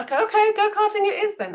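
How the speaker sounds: hammed-up okay, hiding reluctance; forced enthusiasm. Manager pretending to love the go-karting idea, forced cheer.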